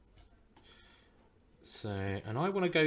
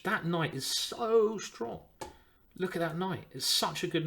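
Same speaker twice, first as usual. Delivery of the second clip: mock admiring; 6 semitones higher than usual. Shown as a demonstration. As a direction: savour it like praising a hero. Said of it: "am I just talking rubbish".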